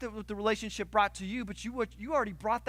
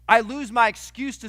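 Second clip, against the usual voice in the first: suddenly louder, driving it home; loud emphasis; 4 semitones higher, 12 dB louder than usual. Shown as a demonstration. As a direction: punch it out to the congregation. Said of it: "do you know what you lose?".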